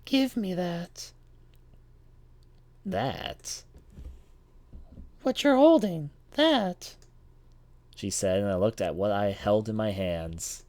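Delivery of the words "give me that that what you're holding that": put-on high, slow, eerie girl voice. Mysterious girl demands the key.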